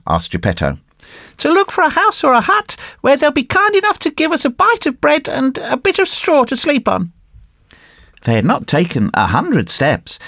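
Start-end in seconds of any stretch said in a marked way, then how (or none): 1.4-7.1 high-pitched little puppet voice; hopeful puppet boy. Acted. Puppet boy answers his father, high and hopeful. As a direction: explain earnestly in a high puppet voice.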